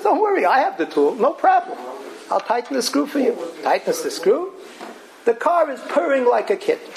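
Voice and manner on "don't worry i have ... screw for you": cheerful mechanic. Retold or imitated. Mechanic voiced in mashal.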